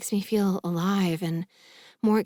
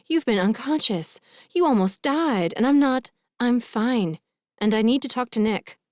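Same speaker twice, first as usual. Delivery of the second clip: agitated, higher-pitched quarrel; heated argument; 5 semitones higher, 4 dB louder than usual. A narrator's reading. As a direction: argue urgently, voice pushed up high. Mother and daughter argue over leaving bed.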